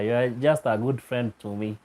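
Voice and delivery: ordinary delivery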